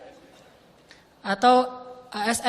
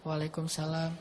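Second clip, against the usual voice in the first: much softer and lower, barely there; reply under your breath so he can't hear; answered softly; 7 semitones lower, 10 dB quieter than usual. Retold or imitated. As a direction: companion quietly answers the Prophet's salam. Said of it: "saya pelankan suara".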